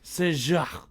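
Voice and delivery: Saint-Jacques said sɛ ʒɑk, lightly French; a lighter echo of Saint-Jacques in French; French accent. Shown as a demonstration. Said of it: "You gotta put a little in there".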